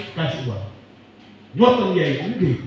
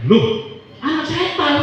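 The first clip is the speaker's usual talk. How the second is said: shocked disbelief; 9 semitones higher, slower than usual. Acted. Young man shocked at insult.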